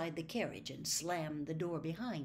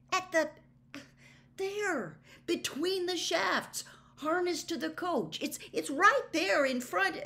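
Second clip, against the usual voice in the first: urgent insistence; 11 semitones higher, 7 dB louder than usual. Acted. Harry insists friend see horse.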